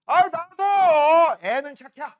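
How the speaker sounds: loud, high, drawn-out Chungcheong drawl; Chungcheong put-down. Acted. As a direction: stretch it out like a Chungcheong backhanded insult. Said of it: "충청도에서 욕할 때 사람 기분 안 나쁘게 욕하는 거".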